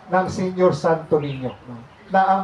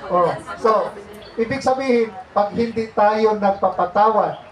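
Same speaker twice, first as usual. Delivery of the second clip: normal talking voice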